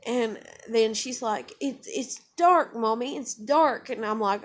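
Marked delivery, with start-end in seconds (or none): 1.6-3.9 lightly voiced small child, slow and higher; drawn-out higher child voice; retold toddler voice. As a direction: imitate a little girl's sleep talk. Voices sleeping daughter in story.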